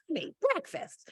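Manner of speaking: put-on high, soft, slow whiny little boy; annoying four-year-old son. Teacher voicing her whiny son for students, high-pitched. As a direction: whine it like a small child wanting food. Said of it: "be my annoying four-year-old son".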